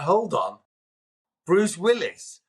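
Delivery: high, incredulous "Bruce Willis?"; stunned disbelief. Retold or imitated. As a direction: retell the shock of hearing his name. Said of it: "And I went".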